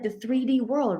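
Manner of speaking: normal talking voice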